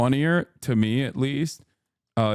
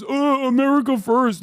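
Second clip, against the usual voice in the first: higher and louder, a dopey put-on voice mocking the fan; mock dumb voice; 11 semitones higher, 4 dB louder than usual. Streamer mocking an America First fan, in a dopey put-on voice. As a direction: ham up a clueless flag-waver saying it. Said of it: "your dumb f-, ass turns around and goes".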